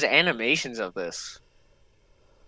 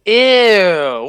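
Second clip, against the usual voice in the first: disgusted ew; 5 semitones higher, 10 dB louder than usual. Real, not acted. Reacting to gross story moment.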